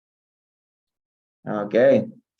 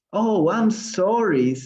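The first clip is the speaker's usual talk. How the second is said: higher, drawn-out storybook apology; crying out; 8 semitones higher, 4 dB louder, slower than usual. A narrator's reading. Teacher reads Alice's apology to the mouse, crying out. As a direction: cry out the apology in a raised voice. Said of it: "cried Alice quickly".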